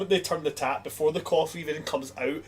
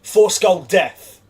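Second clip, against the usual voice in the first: high, loud, drawn-out coffee name; booming announcement; 6 semitones higher, 10 dB louder, much slower than usual. Acted. Barista confirms the customer's order of a brutal coffee, booming. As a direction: proclaim it slowly like a dire warning.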